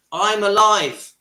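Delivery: loud, slow, thrilled burst; excited exclamation. Real, not acted. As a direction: belt it out with delight. Excited outburst about parents' romance.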